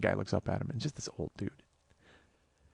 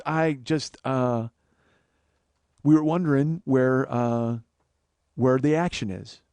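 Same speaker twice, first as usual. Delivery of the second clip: halting, lowered voice of an embarrassed asker; sheepish stammer; 6 semitones lower, slower than usual. Retold or imitated. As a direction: imitate someone blushing at his shoes, stalling on "uh". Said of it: "he's blushing".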